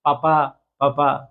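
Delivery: low, slow put-on film voice; movie character imitation. Teacher imitating the Animal movie hero for students, low and slow. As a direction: drag out papa papa like the Animal film scene. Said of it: "আপনারা অ্যানিমেলে দেখছেন না".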